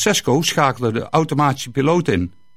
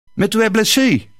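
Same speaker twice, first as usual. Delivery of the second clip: priest in French; 7 semitones higher than usual. Acted. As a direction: switch to French to ask if he is hurt. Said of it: "zei hij".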